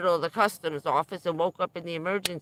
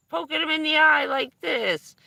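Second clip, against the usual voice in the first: mock poke demo; 10 semitones higher, 7 dB louder than usual.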